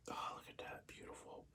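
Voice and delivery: breathless whisper of admiration; awed whisper. Real, not acted. To viewers, whispering in awe over the train's paint. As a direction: whisper it like you're spellbound. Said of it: "I get really emotional and".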